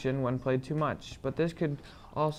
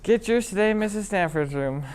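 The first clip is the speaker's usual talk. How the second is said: chipper 1950s ad; 6 semitones higher, 7 dB louder than usual. Acted. Commercial closing line.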